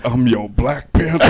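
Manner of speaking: put-on African accent, loud and sultry; mock Wakandan seduction line. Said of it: "in a Wakandan accent".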